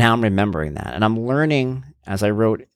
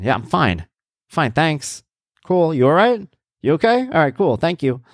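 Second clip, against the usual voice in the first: mock breezy, higher, brushing off concern; curt brush-off; 8 semitones higher than usual. Imitating himself brushing off friends who ask, curt and higher. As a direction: wave them away with fake cheer. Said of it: "I will get offended by it".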